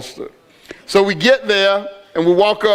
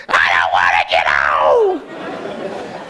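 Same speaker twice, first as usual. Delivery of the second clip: hammed-up crying, dragging out every word; mock crying; 12 semitones lower, much slower than usual. Dad imitating his son crying in line for the roller coaster, hammed-up wail. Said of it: "Immediately he starts crying".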